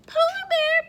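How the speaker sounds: high, loud call to the bear; excited story refrain. Pretend. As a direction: sing out the animal's name high. Reading refrain to children.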